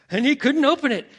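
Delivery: loud, high-pitched retold panic; rising panic. Storyteller repeats line, building to panic.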